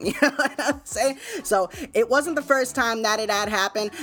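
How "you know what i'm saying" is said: higher-pitched, laughed-through aside; laughing. Real, not acted. Host amused at having to deal with it, real laughing. Laughs after telling of piss mishap.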